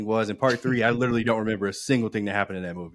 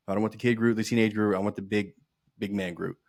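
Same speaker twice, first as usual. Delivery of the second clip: ordinary talk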